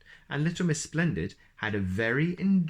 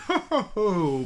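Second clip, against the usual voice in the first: higher, louder ho ho laugh; booming laugh; 10 semitones higher, 5 dB louder than usual. Acted. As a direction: boom out the laugh. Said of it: "boomed the dragon".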